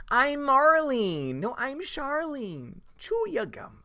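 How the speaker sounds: put-on high, slow, drawled gum-chewing girl voices; mock movie voices. Streamer quoting Spaceballs twins in high drawled voices.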